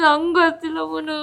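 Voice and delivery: tearful, helpless reply; real crying voice. Real, not acted. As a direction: answer through sobs. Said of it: "ಎಲ್ಲ ವಿಚಾರಕ್ಕೂ ಅಳ್ಬಾರ್ದು".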